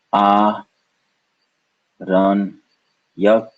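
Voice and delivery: "a, run, yak" as three separate beats; syllable by syllable. Shown as a demonstration. Teacher splitting a word for learners, "a, run, yak" syllable by syllable. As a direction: pause after each of "a", "run", "yak". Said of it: "the second syllable is run".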